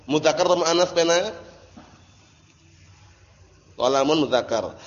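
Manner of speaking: usual speaking voice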